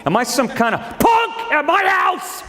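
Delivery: high, slow indignant shout; mock outrage. Father indignant at his spoiled family, shouting high. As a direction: bellow it in mock disbelief.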